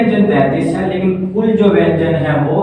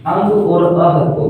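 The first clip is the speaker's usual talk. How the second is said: normal talking voice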